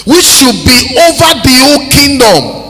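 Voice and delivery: loud, high declaimed Bible reading; preacher's scripture reading. Acted. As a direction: proclaim the scripture at full force.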